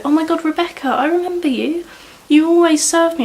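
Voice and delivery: gushing customer. Retold or imitated. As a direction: mimic a delighted regular recognising you. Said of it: "come up to me the other day and go".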